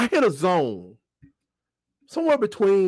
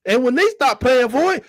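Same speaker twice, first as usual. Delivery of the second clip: ordinary delivery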